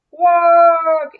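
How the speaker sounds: high, very loud honk; loud dinosaur call. Acted. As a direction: answer like a dinosaur. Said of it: "answered George".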